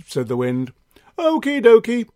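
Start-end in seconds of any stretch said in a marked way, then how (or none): 1.2-2.1 warm beaming sun voice. Acted. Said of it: "said the sun".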